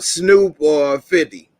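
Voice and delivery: normal talking voice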